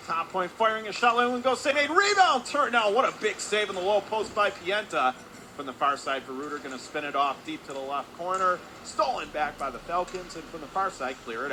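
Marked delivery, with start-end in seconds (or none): 0.1-5.1 climbing to a high, loud peak, then easing; excited save call. Real, not acted. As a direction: build to a shout on the rebound. Live call of a big save.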